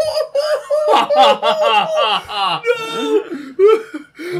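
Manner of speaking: long, high, loud scream; mock terrified scream. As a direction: wail as if waking from a nightmare. Bolting awake screaming.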